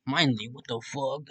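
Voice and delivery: plain reading voice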